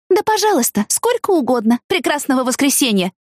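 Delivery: high-pitched mock politeness; airy, defiant send-off; sarcastic. A narrator's reading. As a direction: dismiss her with sweetly pointed courtesy. Sarcastic farewell to angry mother.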